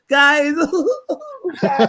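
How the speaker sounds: high, mock tearful giggling on "guys"; imitated giggly protest. Teasing her by acting out her reaction, imitated giggly protest. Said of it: "She starts giggling instantly".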